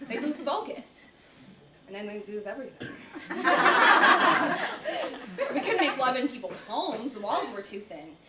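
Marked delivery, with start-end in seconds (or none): 2.9-5.5 loud high laugh. Acted. After men lose everything.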